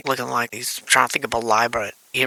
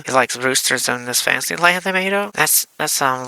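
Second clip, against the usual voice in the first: ordinary delivery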